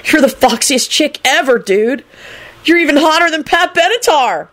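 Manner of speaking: high, rising smitten praise; lovesick gushing. A narrator's reading. Lovesick Kenny keeps gushing.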